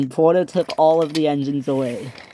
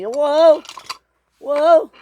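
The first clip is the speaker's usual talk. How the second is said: high, loud yelled whoas; alarmed cry; 11 semitones higher, 7 dB louder than usual. Acted. Sydney as the bridge breaks, alarmed cry. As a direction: yell each one high in panic.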